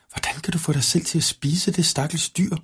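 high-pitched, mildly appalled question; shuddering question. A narrator's reading. Anemone shudders at the wolf eating a rabbit, mildly appalled. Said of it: "spurgte Anemone og gøs".

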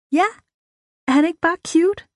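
put-on high, excited girlish crush talk; gushing. A narrator's reading. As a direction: gush about your crush, rising to a high finish. Frida gushing about the boy. (begejstret og forelsket, lyst tonefald)

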